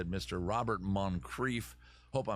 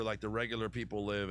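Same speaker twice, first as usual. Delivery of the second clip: usual speaking voice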